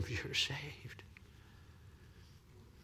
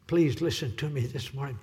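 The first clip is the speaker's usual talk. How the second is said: normal talking voice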